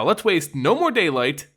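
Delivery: ordinary delivery, nothing marked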